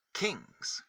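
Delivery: usual speaking voice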